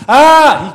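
loud, high wail; imitated toddler cry. Father mimics son who can't talk yet, crying out. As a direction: cry out like a toddler without words. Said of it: "he's crying out".

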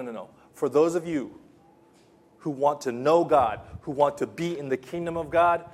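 usual speaking voice

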